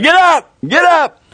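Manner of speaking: high-pitched ringside cheer; imitated urging cheer. Ringside supporter to a boxer on the canvas, imitated urging cry. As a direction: urge a fallen fighter up, pitch leaping high. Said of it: "Others saying".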